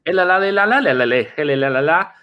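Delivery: imitating a Portuguese defender warning; Portuguese gibberish shouted at a teammate; mock Portuguese gibberish. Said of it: "going in Portuguese".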